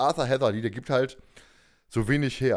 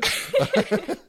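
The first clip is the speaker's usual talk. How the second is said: high giggle rising; real laugh; 4 dB louder than usual. Real, not acted. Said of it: "laughing at the reader".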